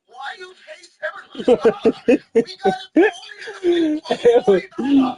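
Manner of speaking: high vendor impression building to a loud yell; incredulous Chinese vendor. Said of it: "He goes".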